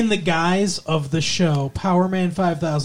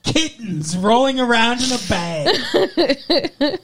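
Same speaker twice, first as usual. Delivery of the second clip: slow, higher, laughing through it; laughing disbelief; 5 semitones higher, slower than usual. Real, not acted. Mocking the kittens comparison, slow and laughing. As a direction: giggle the phrase out in disbelief.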